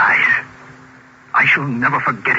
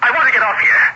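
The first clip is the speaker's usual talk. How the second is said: louder, higher urgent plea; frightened demand; 9 semitones higher, 3 dB louder than usual. Acted. Passenger begs the driver to stop, frightened. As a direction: insist on getting off, scared.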